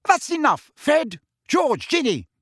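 high, loud scolding mother's voice. A narrator's reading. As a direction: tell the three kids off sharply. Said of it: "said Missus Weasley".

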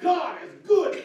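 high, loud joyful cry; elated shout. Acted. David rejoicing over the Ark, shouting. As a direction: shout it like a man beside himself with joy. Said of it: "He's just like".

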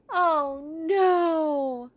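high, loud groan of loss; dismayed. Acted. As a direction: wail at the empty spot. Gold and leprechaun gone.

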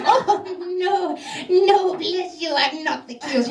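slow, lightly voiced hunchback denial; mock coy denial; hunchback keymaster. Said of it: "He's like".

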